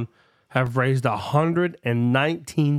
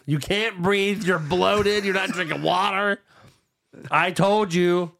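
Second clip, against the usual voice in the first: higher, scolding wife impression; nagging wife voice; 5 semitones higher, slower than usual. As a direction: play the nagging wife, laughing through it. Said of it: "She's like".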